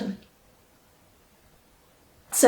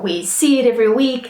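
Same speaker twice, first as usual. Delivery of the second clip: ordinary delivery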